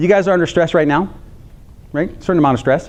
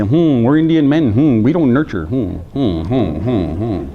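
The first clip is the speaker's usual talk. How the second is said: low, gruff mock grunting hmms; lightly voiced stoic men with low hums; a hint of proud men humming low; imitating Native men; 4 semitones lower, slower than usual. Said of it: "they say".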